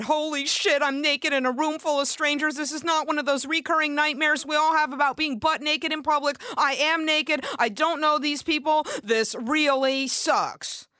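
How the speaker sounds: high, loud rising panic; freaking out on stage in front of strangers; loud mock panic; panicked.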